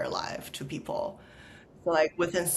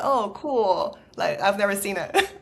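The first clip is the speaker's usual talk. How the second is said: mock cheerful reply; 3 semitones higher, 5 dB louder than usual. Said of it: "I'm like".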